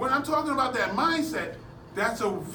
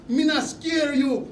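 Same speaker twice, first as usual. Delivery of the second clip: slow, higher-pitched mock Jamaican; mock Jamaican accent; 6 semitones higher, 5 dB louder than usual. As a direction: square up to someone in an imitated Jamaican accent. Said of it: "his accent was so strong".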